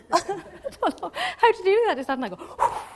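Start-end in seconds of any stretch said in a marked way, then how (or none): 0.1-2.0 high, loud, laughing question. Real, not acted. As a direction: burst out laughing as you ask. Amused wondering about Jesus breathing.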